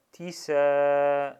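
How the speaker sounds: tisa said loud with a long final a; drawn-out tisa. Shown as a demonstration. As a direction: stretch the a in tisa. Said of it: "On va faciliter la chose". (tisa avec un a prolongé et fort)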